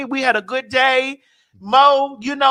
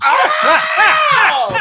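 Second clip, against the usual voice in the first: loud, high whooping "Oh!"; high hollered cry of approval; delighted shriek; 5 dB louder than usual. Real, not acted. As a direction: shriek with laughter at a great line. Reacting to friend's sizzling line.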